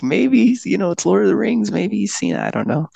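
high, quick, hopeful musing; hopeful thought. Retold or imitated. Patient hoping the rescuer knows the film, high and quick. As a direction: lift your voice as you half-hope he got it.

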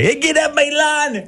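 high hammed-up yell at the crowd; mock yell. Neighbour yells at the Prime Minister to get off, mock shout. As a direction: squawk it way up like an angry neighbour. Retelling homeowner yelling at PM.

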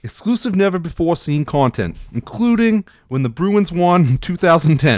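lightly put-on Boston accent, ad pitch. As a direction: sell the library like a proud Boston fan. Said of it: "read it in a Boston accent".

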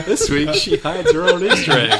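hammy trailer voice through laughter; TV promo announcer. Pretend.

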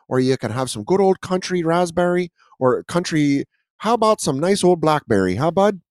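lilting Canadian sales pitch; mock Canadian accent. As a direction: sell it as a cheery mock Canadian. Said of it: "wildly offended by our accents".

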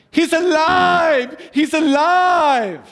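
high, loud, slow triumphant cry; drawn-out ecstatic Frankenstein scientist shout; mad scientist cry. Retold or imitated. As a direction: shout it like a mad scientist whose creation wakes. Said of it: "the scientists went".

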